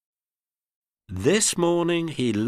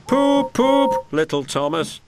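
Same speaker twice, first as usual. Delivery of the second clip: very high, loud whistle-like poop poop; whistling poop; 6 dB louder than usual. Acted. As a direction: toot it like an engine whistle. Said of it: "he whistled".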